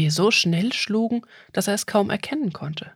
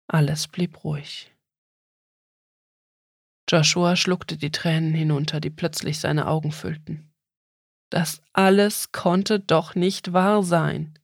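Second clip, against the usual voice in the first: ordinary delivery, nothing marked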